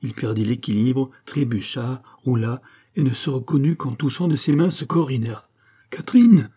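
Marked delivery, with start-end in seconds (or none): 5.9-6.5 very high, loud cry of the name, falling away; horrified acted shout; shocked cry. A narrator's reading. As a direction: cry out on finding the body. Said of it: "s'écria-t-il".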